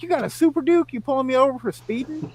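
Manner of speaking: high, loud disbelieving retort; can't-believe-it questioning; incredulous. Retold or imitated. Retold disbelief at cop's hypocrisy.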